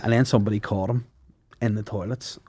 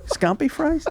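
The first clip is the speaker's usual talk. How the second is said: squeaky high voice; slower than usual. Pretend. Mock squeal at a food name.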